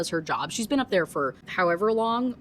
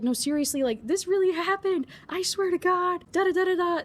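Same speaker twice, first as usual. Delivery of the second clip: mock crying plea; 10 semitones higher than usual. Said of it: "But she just keeps crying".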